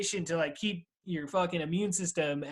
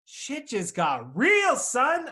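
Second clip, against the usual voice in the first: slow, high, hammed-up proclamation; mock dramatic; 8 semitones higher, 5 dB louder, much slower than usual. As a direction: drag it out for drama.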